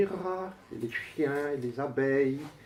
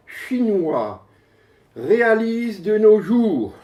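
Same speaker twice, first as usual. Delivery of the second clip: raised, emphatic recitation with long pauses; poem declamation; 10 semitones higher, 9 dB louder, much slower than usual. Acted. Poet unveils the biggest computer to the audience, declaimed. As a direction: proclaim it phrase by phrase.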